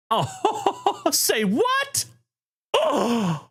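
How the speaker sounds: falsetto squeal of disbelief, breaking into a laugh; shocked squeal. Real, not acted. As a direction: squeal and gasp at the surprise. Stunned by a huge gift.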